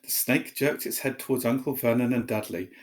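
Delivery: usual speaking voice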